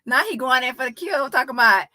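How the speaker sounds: ordinary delivery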